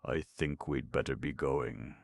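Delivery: usual speaking voice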